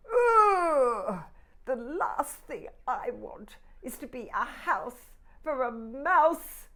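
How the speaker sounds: loud high disgusted ugh. Acted. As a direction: shudder with disgust. Teapot disgusted by mouse.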